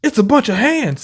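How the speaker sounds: high alarmed voice. Retold or imitated.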